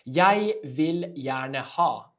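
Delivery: slow, loud drawn-out request; slow model phrase. Shown as a demonstration. Teacher repeating the phrase, slow and loud. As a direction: say it slowly so they catch it. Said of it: "learn this one".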